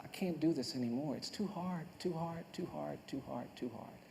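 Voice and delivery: hushed, worn-down repetition, fading away; quiet despair. Retold or imitated. Retelling his despairing thought after execution.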